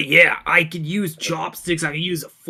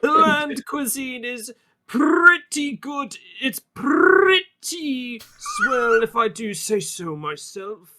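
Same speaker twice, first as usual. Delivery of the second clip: character voice; 6 semitones higher, slower than usual. Acted.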